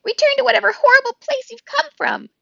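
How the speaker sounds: very high, loud squeak; high frightened weasel squeak. A narrator's reading. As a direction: squeak in terror like a tiny weasel. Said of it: "squeaked Dart".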